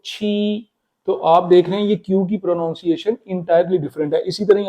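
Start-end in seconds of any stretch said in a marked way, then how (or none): 0.1-0.7 "छी" held long and level in Mandarin first tone; aspirated ch, sustain "छी" on one pitch. Shown as a demonstration. Teacher demonstrating first tone, long even "छी". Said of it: "first टून में इसको करूं".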